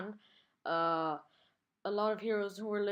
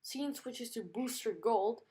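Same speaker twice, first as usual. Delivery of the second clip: ordinary delivery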